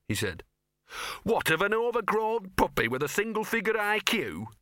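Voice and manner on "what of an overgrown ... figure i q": high sneering dog voice, scornful. A narrator's reading. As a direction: sneer it out with contempt. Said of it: "sneered Gaspode".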